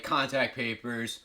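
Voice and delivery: normal talking voice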